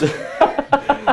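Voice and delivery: high, loud burst of laughter; real laugh. Real, not acted. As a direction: laugh hard at the story. Laughing at wife's quip.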